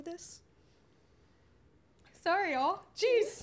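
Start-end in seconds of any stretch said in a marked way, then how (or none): 2.2-3.4 fighting back sudden tears; choking up. Real, not acted. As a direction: voice cracking high and loud as tears come. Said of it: "I can't cry".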